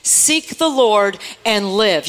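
very slow, drawn-out refrain with the congregation; slow call and response. Shown as a demonstration. Preacher leading the church to repeat slowly after her. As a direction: stretch every word so they can say it with you. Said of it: "Say it with me".

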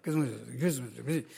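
low, soft repeated grumble; grumbling sound. Shown as a demonstration. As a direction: mimic grumbling under your breath. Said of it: "That's what it sounds like".

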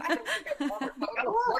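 high, fast, laughing through it; giggling disbelief. Real, not acted. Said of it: "I have to be honest and think about it".

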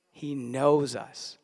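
"He knows us" drawn out slowly, each word weighed; slow it right down and let "knows" land; slow emphasis. Shown as a demonstration. Pastor repeats the point to the church, slow and weighty. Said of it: "pastor repeats his point".

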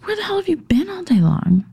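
high, hissed confrontation; whisper-demand where he's been; angry whisper. Retold or imitated. Wife confronting drunk husband, high hissed whisper. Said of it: "And I was like".

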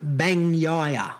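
"Bagnaia" drawn out slowly, every syllable clear; slowed down. Shown as a demonstration. Host slows the name down for co-host, slowed down. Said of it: "I'll slow it down for you".

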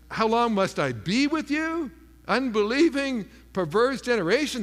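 acted exasperation in a scolding quote; Jesus, harsh rebuke. Disappointed with the faithless generation, harsh rebuke. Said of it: "Now, that's harsh, right?".

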